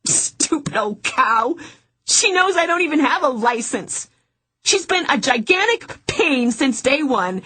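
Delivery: high, strained, near tears, voice cracking; tearful rant. Acted. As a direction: vent it through tears.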